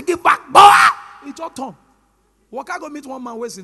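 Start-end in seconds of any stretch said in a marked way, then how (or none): 0.0-0.6 high, loud mock shout; retold shout. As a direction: bark it like a fed-up boss. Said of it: "I say".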